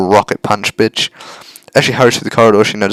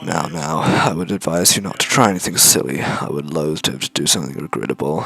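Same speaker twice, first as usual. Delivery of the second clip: soft menacing villain; 5 semitones lower, 6 dB quieter than usual. Acted. Villain warns hostages softly.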